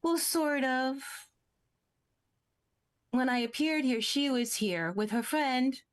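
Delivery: a hint of a small, innocent creature; lightly voiced little creature. Little creature explaining how it got here, lightly voiced.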